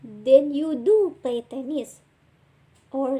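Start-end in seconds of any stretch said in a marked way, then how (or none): 0.0-2.0 "do" stretched and punched louder; stress on DO. Shown as a demonstration. Teacher showing emphatic present tense, loud slow stress on "do". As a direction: hit "do" slowly and firmly. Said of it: "do or does with emphasis".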